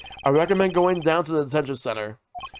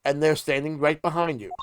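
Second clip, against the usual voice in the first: ordinary talk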